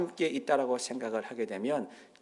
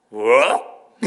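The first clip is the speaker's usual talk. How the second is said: low startled huh sound; shocked gasp; 6 semitones lower, 6 dB louder, much slower than usual. Retold or imitated. Child hears mother's sudden tone change, startled gasp. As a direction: gasp in disbelief like a kid. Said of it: "제가 그대로 할게요".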